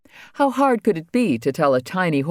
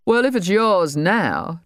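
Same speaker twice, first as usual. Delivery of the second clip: a suspicious trader, higher and drawn out; higher, slow Trader voice; 6 semitones higher, slower than usual. A narrator's reading. Trader questions the fisherman about the bucket, higher and slow. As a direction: lift the voice, slow and probing.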